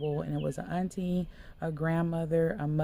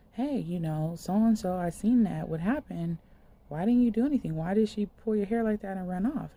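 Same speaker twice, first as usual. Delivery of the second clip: louder, higher, pressing questions; raised confronting voice; 4 semitones higher, 6 dB louder than usual. Retold or imitated. Speaker to the girl after the attack, raised urgent questions. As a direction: demand answers from the girl, loud.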